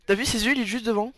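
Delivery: alarmed at the monster ahead; startled and scared. Real, not acted. Voit le loup, effrayé. (voix haute et affolée)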